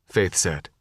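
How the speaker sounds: usual speaking voice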